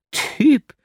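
high, loud incredulous cry; surprised cry. A narrator's reading. Girl crying out in amazement at his answer, high and loud. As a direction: burst out with amazement. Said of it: "Rief das Mädchen aus".

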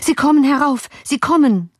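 high, urgent calling out; alarmed cry. A narrator's reading. Servant warning master of soldiers, alarmed cry. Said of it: "rief der Diener".

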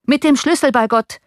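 high, exasperated retort; exclaiming. A narrator's reading. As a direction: call it out as if obvious. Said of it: "rief der Kutscher".

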